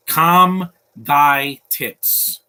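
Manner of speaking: very slow, loud drawn-out scold; mock scolding. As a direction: drag it out loud to tease the viewers. Mock warning to viewers.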